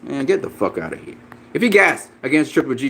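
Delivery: ordinary talk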